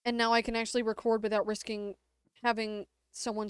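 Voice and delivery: usual speaking voice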